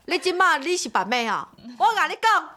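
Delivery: high, scolding Taiwanese rant; mock angry caller. Caller scolding the seller, high mock anger. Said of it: "然后就回我说".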